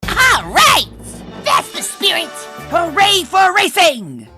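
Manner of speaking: high, loud hurrah; excited cheering. Acted. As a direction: whoop it up to rally a friend. Cheering friend's agreement to race.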